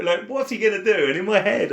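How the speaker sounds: ordinary talk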